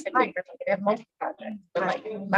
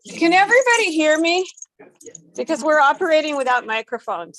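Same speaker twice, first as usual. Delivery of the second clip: raised, higher voice to carry across the room; voz projetada; 6 semitones higher, 6 dB louder than usual. Real, not acted. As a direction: project to the back without a mic. Addressing the room without a microphone.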